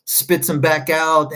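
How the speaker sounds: ordinary delivery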